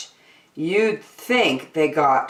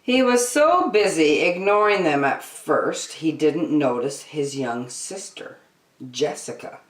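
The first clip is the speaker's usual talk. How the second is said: plain reading voice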